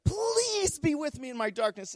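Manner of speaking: very high, drawn-out plea; imitated tearful pleading. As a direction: stretch out her plea, pitched far up. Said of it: "she's pleading with Jesus".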